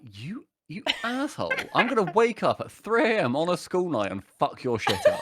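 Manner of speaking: high-pitched, indignant mock rant; acted outrage. Gamer cursing whoever destroyed his builds, acted outrage. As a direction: play up the outrage, voice jumping high.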